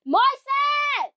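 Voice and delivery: high shout across the house; calling out. Real, not acted. Calling out for help.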